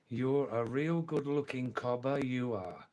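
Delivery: word-by-word careful delivery. Shown as a demonstration. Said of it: "I'll say it slowly this time".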